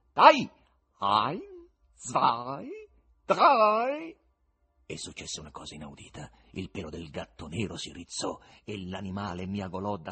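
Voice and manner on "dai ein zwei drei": loud count peaking on the last word; shouted countdown. Acted. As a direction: cue an attack like a circus act.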